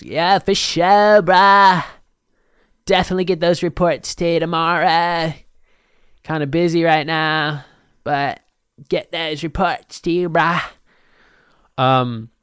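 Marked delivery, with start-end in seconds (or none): 0.0-10.6 bro voice. Pretend. Answering his boss about reports, higher laid-back bro voice. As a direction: brush off the boss like a chill dude. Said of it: "Boss is like".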